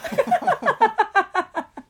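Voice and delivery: high, loud laughter, falling off; long helpless burst of laughter. Real, not acted. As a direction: laugh hard at being teased. Teller laughs at teasing.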